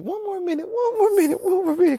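high pleading self-bargain; high whining plea; groggy bargaining. Retold or imitated. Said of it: "I'm like".

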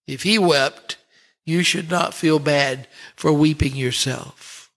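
ordinary delivery

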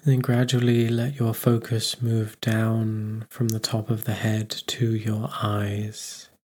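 normal talking voice

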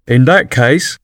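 grave, formal dodo voice; a solemn bird opening a motion. A narrator's reading. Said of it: "said the dodo solemnly".

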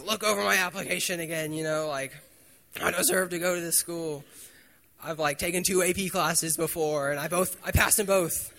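a touch of mock outrage; pleading your case, indignant. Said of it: "I was really kinda mad".

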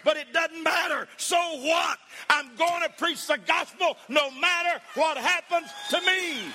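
high, loud preaching shout; shouted climax. Acted. Preacher cries out to the church, loud shouted climax. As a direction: shout it out in triumph.

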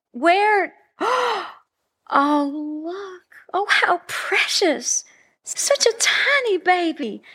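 put-on high, slow cooing over a baby.

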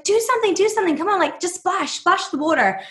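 high, nagging, impatient "do something"; pushy girlfriend. Retold or imitated. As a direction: poolside partner ordering her man about, pushy and high. Woman bossing partner at photo shoot.

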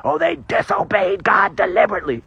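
put-on objector's voice; mock accusation. Preacher mimics those blaming Adam and Eve, mock accusation. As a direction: imitate someone piously pointing the finger.

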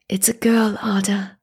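a tender half-whisper; softly. Acted. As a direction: tell her gently, almost whispering. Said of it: "said my husband softly".

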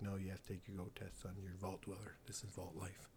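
low, hushed dad voice; lightly voiced stern dad, kept quiet; dad laying down rules. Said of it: "Your dad's like".

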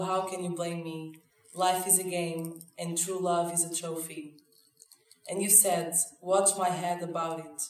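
slow, measured lyric reading; poem recitation. Acted. Recites [name] lyrics.

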